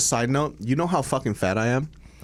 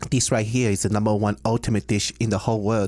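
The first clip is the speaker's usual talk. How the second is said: put-on Cantonese-English accent; light Cantonese accent, played for laughs; Americanized Chinese accent. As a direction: imitate a Hong Kong Chinese man speaking English. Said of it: "It's like an Americanized Chinese accent".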